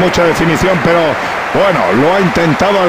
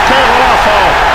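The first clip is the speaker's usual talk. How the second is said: breakneck goal commentary; 3 semitones higher, 4 dB louder than usual. Acted.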